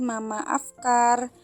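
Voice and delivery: ordinary talk, nothing marked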